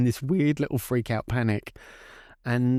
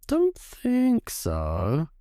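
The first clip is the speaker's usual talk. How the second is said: put-on sceptical child; 4 semitones higher, much slower than usual. Child doubting mum's hibernation idea.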